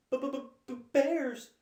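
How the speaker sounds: very high, loud stammering fright; frightened stutter. A narrator's reading. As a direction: blurt it out in panic, stuttering. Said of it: "she blurted out, very frightened".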